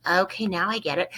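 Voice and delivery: usual speaking voice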